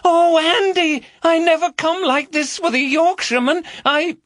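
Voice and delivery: loud high falsetto teasing. Acted. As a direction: shout the fake woman's moans down the phone. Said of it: "shouted even louder".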